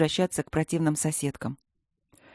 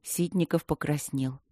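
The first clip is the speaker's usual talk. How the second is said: ordinary delivery, nothing marked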